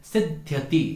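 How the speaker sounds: सिद्ध्यति with both half ध and य sounded; correct pronunciation. Shown as a demonstration. Teacher models the right form for the class, clearly. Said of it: "दोनों को बोलना है".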